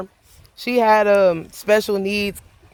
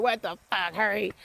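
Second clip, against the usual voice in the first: much softer, hurried under the breath; muttered urgency; 11 dB quieter than usual. Retold or imitated. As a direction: hiss it quietly, impatient. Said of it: "I'm like".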